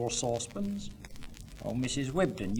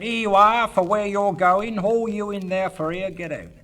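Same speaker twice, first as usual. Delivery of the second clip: high, loud porter's holler; 6 semitones higher, 7 dB louder than usual. Retold or imitated. Porter hollering at train passengers, loud and high. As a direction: call it down the platform. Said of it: "he just hollered out".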